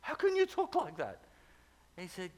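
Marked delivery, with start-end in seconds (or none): 0.0-1.2 much higher, aghast question; horrified protest. Retold or imitated. Pastor protesting to God, aghast and high. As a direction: protest in shocked disbelief. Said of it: "And he was horrified".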